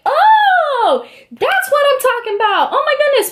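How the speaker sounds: very high, drawn-out mock squeal of delight; high-pitched gushing approval; excited approval. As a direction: imitate a thrilled judge cheering someone on. Imitating judge thrilled by her answer.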